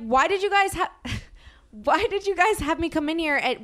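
high-pitched, exasperated questioning; incredulous retold outburst; indignant complaint. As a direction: protest in disbelief, pitched high. Acting out indignation at the dealership.

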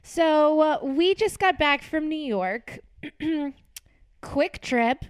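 loud and high, mock announcer; loud radio voice. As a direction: project like a radio host. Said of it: "Did you hear that?".

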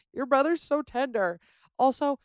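ordinary talk, nothing marked

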